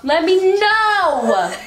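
high, very slow, dragged-out "know"; exasperated repeating. Real, not acted. Player correcting a wrong guess, exasperated and drawn out. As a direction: stretch "know" high and long.

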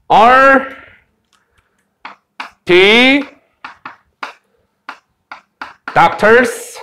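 "doctors?" lifted at the end as a question; rising question tune. Shown as a demonstration. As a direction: say "Are they doctors?" slowly word by word, ending upward on "doctors". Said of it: "요렇게 올려서 읽어요". ("doctors?" 끝을 올려 묻는 억양)

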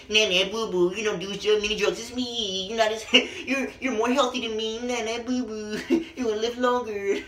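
high, cooing put-on voice with giggles; mock rapper reply. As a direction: talk down sweetly, laughing through it. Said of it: "Who says that? Like".

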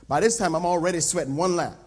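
usual speaking voice

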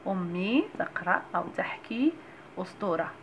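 ordinary talk, nothing marked

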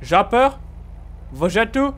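ordinary talk

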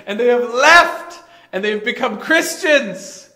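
high, loud prayer broken by laughter; two fathers complaining to God, cracking up; mock prayer, laughing through it. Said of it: "they say".

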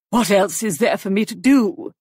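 loud, indignant demand. A narrator's reading. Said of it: "she demanded".

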